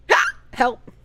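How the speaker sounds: ordinary delivery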